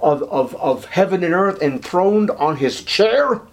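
ordinary talk

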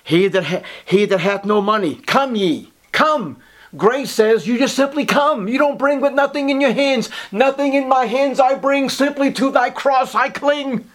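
fervent preacher pleading with the flock to come; preaching at full force. Acted. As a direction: lift it up and plead.

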